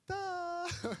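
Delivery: high, held-out "duh"; mock dopey realisation. Student told about the water, exaggerated dumb realisation. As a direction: play dumb on one high drawn note.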